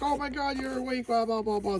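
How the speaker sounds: high, breathless mock gush; wife gushing at her husband waking, pitched high. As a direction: gush like a relieved wife, hammed-up.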